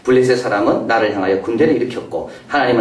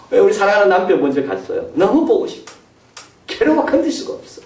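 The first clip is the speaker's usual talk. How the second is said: grieving widow; 7 semitones higher than usual. Retold or imitated. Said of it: "해달라고".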